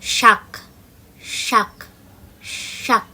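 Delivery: শাক with the tongue on the palate, a clear sh; teacher demonstrates the palatal s. Shown as a demonstration. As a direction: stretch the sh in শাক. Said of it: "তালিবস্যের উচ্চারণ".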